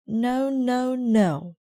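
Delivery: high, loud repeated no; insistent no. Acted. Detective rejecting the officer's idea, loud and high. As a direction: firmly deny it.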